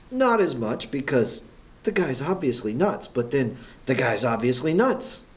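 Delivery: normal talking voice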